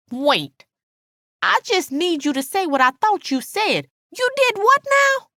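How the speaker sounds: high voice climbing into disbelief; incredulous, high-pitched friend; stunned Alice. A narrator's reading. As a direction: demand a repeat, pitch rising sharply at the end.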